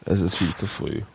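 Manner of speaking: low, soft too-early voice; morning voice. Real, not acted. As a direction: say it low and quiet, barely up yet. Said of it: "Man hört es dir an".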